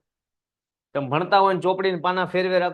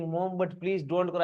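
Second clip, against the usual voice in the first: slow soft recitation; 5 dB quieter, much slower than usual. Shown as a demonstration. Recites poem line softly.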